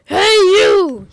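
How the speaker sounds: shouted from a cliff top; yelling. A narrator's reading. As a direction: yell it down at him. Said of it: "he yelled at him".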